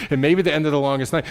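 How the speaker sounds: preacher speeding up to turn toward hope; fast, driving. Shown as a demonstration. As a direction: rush it forward with energy.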